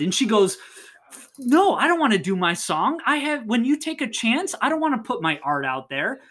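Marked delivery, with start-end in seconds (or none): 1.4-6.2 high-pitched mock protest; lightly acted annoyance; mildly mad. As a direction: act out an indignant refusal, voice lifted. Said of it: "she gets mad at him".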